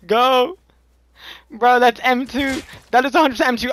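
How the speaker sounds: high, loud urgent warning; alarmed shout. Real, not acted. Enemy gun heard in chase.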